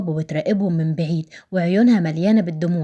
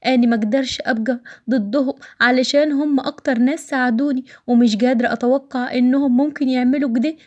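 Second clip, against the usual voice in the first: higher-pitched voice of a woman pleading; 5 semitones higher than usual. Acted. A woman begging Zein not to leave her, higher pleading voice. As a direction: plead with him, pitched up throughout.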